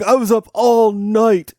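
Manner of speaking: high, slow mock whine; whiny student. Teacher imitates the tired student's excuse, high and whiny. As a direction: drag it out like a sulky teen. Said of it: "He's like".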